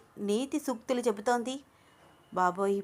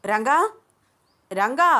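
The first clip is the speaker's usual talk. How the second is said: loud call, rising at the end; calling loudly; 4 semitones higher, 8 dB louder, slower than usual. A narrator's reading. Shweta loudly calling Ranga to come forward. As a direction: call his name out across the room. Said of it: "గట్టిగా పిలిచింది".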